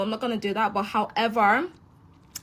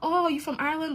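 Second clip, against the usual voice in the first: higher puzzled question; higher, mock confused; confused English asker; 8 semitones higher than usual. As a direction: imitate a baffled stranger. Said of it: "people are like".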